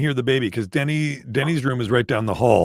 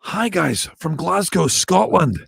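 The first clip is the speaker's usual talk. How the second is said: put-on higher-pitched Glasgow accent; playful Glaswegian greeting, pitched up; Scottish accent; 6 semitones higher, slower than usual. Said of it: "do all of his accents".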